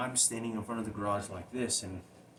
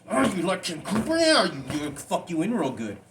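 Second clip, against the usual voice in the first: very high, loud mocking snarl of the abuser; taunting abuser; 10 semitones higher, 14 dB louder than usual. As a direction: spit it out loud as the cruel attacker. Abuser's taunting words voiced.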